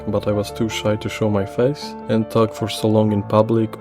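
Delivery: plain reading voice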